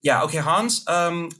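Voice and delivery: polite executives. Retold or imitated. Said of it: "three people on the other side that's like".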